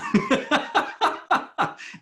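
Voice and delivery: high breathy burst of laughter; real laugh. Real, not acted. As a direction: laugh it off, embarrassed. Laughing after admitting he wasn't ready.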